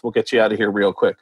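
quick, low-key reassurance; brisk brush-off. Retold or imitated. Mike putting off a question about Seth, brisk brush-off. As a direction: say it fast and offhand. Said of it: "he goes".

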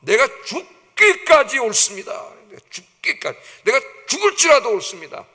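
high, loud, defiant protest; acted anger. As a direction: play Jonah arguing angrily with God. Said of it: "화를 내면서".

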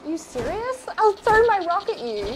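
ordinary delivery

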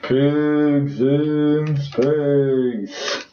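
very slow stretched announcer voice; drawn-out announcer. Pretend. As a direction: stretch every word like a trailer voice.

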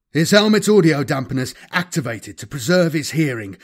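usual speaking voice

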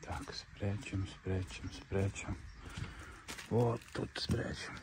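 hushed whisper while hiding the toy. Real, not acted. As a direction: whisper so the dog won't hear. (шёпотом)

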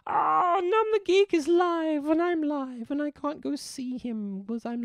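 mock despair at missing a friend's stream; dismayed gasp. Real, not acted.

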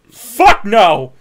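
loud panicked yell; terrified kid. Retold or imitated. The traumatized kid seeing Ents, loud yell. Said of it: "imagine when he saw those Ents".

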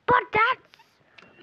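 high, loud squeaky outburst, cut short; mock outrage. Customer reacting to the huge price, squeaky mock outrage. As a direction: start a squealed play-acted protest.

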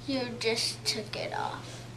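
whispering. Real, not acted. Groggy patient insists, whispering.

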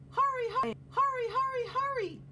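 high, loud urgent urging; high loud hurried urging. Acted. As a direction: push them to hurry, voice way up high. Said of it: "urged Bear".

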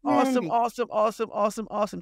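high, loud, repeated awesome; thrilled host cheers right after the boom; excited cheering. Real, not acted.

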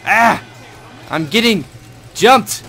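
high, loud startled yell; panicked yell. Real, not acted. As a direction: shout in panic while ambushed. Ambushed in game.

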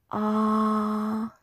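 "Ah" held long as for a doctor; high level tone. Shown as a demonstration. Patient opening mouth for doctor, level "Ah". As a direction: open up and sustain "Ah" evenly. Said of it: "when we go to the doctor".

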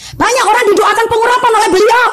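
high, forceful preacher's cry; seruan khotbah. Real, not acted. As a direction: proclaim it high to the congregation. Preacher exclaims before a challenging question. (berseru lantang dengan nada tinggi)

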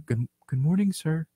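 subdued late-arrival impression; Kurt voice. Imitating a co-worker walking in late, subdued mock greeting. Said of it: "he's like".

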